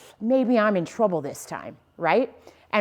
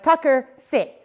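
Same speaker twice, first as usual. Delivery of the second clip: a firm, slightly raised "Tucker, sit"; trainer command; 5 semitones higher, 3 dB louder, slower than usual. Shown as a demonstration. Trainer demonstrating a sit command to the dog. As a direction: call the dog's name then the command. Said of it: "I'm like".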